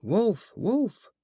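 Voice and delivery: high, rising call of wolf; mock alarm cry. A narrator's reading. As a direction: call out for help, pitch jumping up. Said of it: "by crying".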